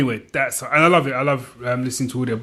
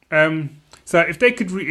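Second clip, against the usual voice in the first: normal talking voice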